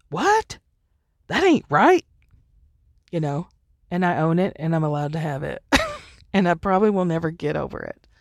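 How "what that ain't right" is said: high, loud mock outrage at unfairness; indignant outburst. A woman voicing her inner justice streak, indignant. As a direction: squawk it like you've caught an injustice. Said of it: "I have a theme of".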